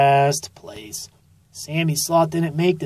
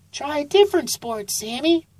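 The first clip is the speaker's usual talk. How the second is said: high, gentle mom voice; a raised, motherly storybook voice; high-pitched mom encouraging her son; mother sloth voice; 10 semitones higher than usual. A narrator's reading.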